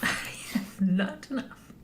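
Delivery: a light hammed-up copy of the line; mock character line. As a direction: say it slowly and softly, as if in character. Echoes partner's in-character line.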